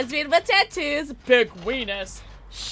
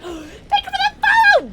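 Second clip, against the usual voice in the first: excited; 7 dB louder than usual. Real, not acted. Excited thanks to follower.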